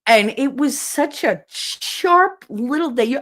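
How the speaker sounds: ordinary delivery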